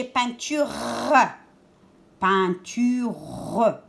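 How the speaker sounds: "peinture" with a long final R; emphasized R sound. Shown as a demonstration. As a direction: sound out "peinture", holding the R at the end. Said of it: "j'entends le R?". (« peinture » en appuyant longuement sur le R final)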